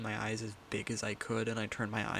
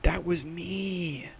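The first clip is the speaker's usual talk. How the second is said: mock dramatic; 4 semitones higher, 6 dB louder, slower than usual. Mock tale of being buff.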